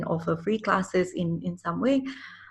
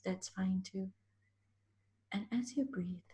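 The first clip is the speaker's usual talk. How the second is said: guided meditation whisper; 7 dB quieter than usual. Acted. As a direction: breathe it gently, right in your ear. Leads a one-minute breathing pause.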